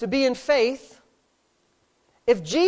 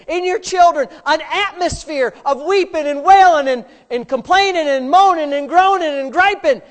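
louder, higher, ringing pulpit delivery; full-force preaching; 4 semitones higher, 7 dB louder than usual. Acted. Pastor preaching hard to his church, loud and high. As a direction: drive it home to the congregation.

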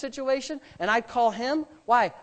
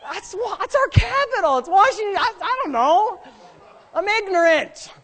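high, loud mock fluster; flustered outburst; 10 semitones higher, 5 dB louder than usual. Owning up to ignorance before his friend, flustered. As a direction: stumble over it, pitched way up.

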